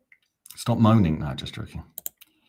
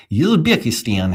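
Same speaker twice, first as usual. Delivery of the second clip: louder, slow, higher Uzbek accent attempt; 5 semitones higher, 7 dB louder, slower than usual. Shown as a demonstration. Streamer trying an accent for viewers, attempted Uzbek accent. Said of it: "I can't do the accent".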